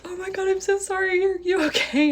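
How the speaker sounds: very high-pitched imitation of frantic apology; mock panic, held high throughout; panicked apology. Date apologizing after crashing the car, high panic.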